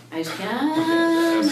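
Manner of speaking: high, slow, drawn-out whine; mock whimper of a kid who won't; whiny kid voice. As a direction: stretch it out like a whining child. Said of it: "I just can't stand whining".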